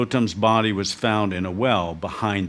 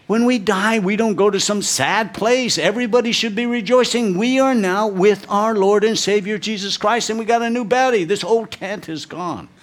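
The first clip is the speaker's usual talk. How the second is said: higher, louder preaching voice; preaching at full force; 10 semitones higher, 4 dB louder than usual. Acted. Pastor rousing the church about heaven, fervent preaching. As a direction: proclaim it with fervor.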